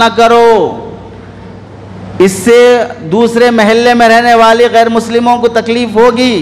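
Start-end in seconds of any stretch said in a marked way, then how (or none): none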